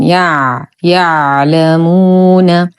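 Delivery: "يع" alone, then slow recited "يعلمون"; still ayn. Shown as a demonstration. Teacher isolates the ayn for learners, slow "يع، يعلمون". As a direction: close firmly on the ع of "يعلمون" without a vowel. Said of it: "ننتبه كثيرًا لإسكان العين".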